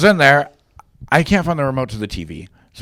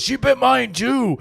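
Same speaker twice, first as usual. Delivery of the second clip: high, drawn-out exclamation; slow, high-pitched mock disbelief; shocked revelation; 11 semitones higher, slower than usual. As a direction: act out the friend's amazed outburst. Retold friend's shocked revelation.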